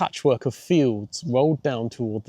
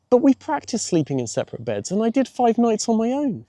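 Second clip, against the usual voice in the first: put-on a higher girl's voice, pleased with herself; young girl boasting proudly, pitched higher; 11 semitones higher than usual. A narrator's reading. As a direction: lift it up, proud of her achievement. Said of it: "Phoebe said proudly".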